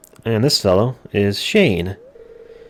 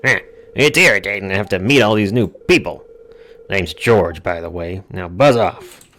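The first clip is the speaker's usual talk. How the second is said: put-on grumpy, irritated old grouch; George; 4 dB louder than usual. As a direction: grumble with loud bursts on key words. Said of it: "but George is here".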